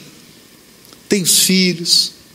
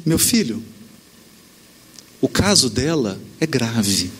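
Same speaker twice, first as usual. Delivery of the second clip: calm gentle reply; 11 semitones lower than usual. Retold or imitated. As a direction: speak as a serene spiritual guide. Chico responde com calma. (calmo e sereno, voz baixa de conselho espiritual)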